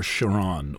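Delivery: "Chiron" said as shuh-RON; soft SH pronunciation. Shown as a demonstration. Offering listeners a pronunciation choice, "Chiron" as shuh-RON. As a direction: say "Chiron" with SH and stress on the end. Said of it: "however you choose to pronounce it".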